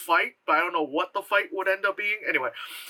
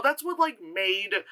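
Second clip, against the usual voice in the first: normal talking voice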